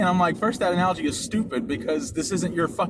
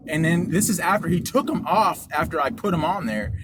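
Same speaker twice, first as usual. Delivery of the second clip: ordinary delivery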